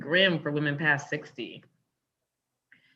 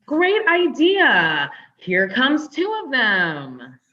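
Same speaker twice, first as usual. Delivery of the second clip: much higher, louder, slow mock-cheery jab; sarcastic; 7 semitones higher, 6 dB louder, much slower than usual. [name] mocks Hank as his dates arrive, slow and sarcastic. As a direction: drag it out with mocking glee.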